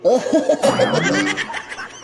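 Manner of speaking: amused laughing fit; burst of laughter. Acted. Friend laughs at the silly answer about the week. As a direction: crack up at the joke.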